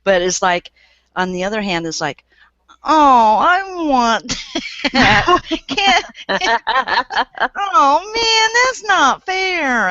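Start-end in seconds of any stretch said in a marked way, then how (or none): 2.8-9.9 high, whiny, half-laughing complaint. Pretend. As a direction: whine like a kid denied a treat. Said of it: "I start my whiny voice".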